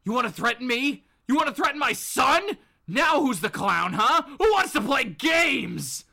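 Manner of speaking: loud, rising angry challenge; angry threat. Acted. Bob pointing the gun at the Joker, angry threat. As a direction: threaten like a father pushed too far. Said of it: "and he goes".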